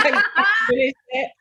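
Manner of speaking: laughing through the words as the pitch jumps up. Real, not acted. Recalling the phone row, she laughs while telling it. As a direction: laugh at her own nerve.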